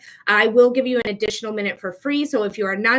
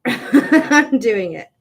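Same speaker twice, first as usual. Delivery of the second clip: slow, giggling defiance; laughing; 6 dB louder, much slower than usual. Real, not acted. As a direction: laugh through it, a bit cheeky. Defiantly breaks the candle rule.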